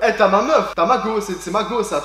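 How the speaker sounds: a hint of loud street-slang joking; slangy street voice. Joking about the app name to viewers, loud slangy call. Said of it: "C'est pas Tamago en mode".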